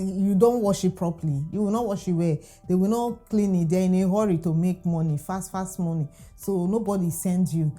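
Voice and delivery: ordinary talk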